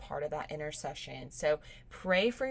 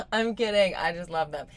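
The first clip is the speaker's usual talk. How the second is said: said through laughter, louder; laughing; 8 dB louder than usual. Real, not acted. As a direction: laugh the words out. Laughing off her joke.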